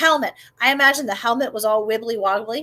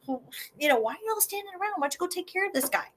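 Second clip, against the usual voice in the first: hushed, higher sarcastic jab; sarcastic muttering; 6 semitones higher, 10 dB quieter, much faster than usual. Acted. Eliab needling the soldiers, softly sarcastic. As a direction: mutter a snide dare. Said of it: "his brother's saying".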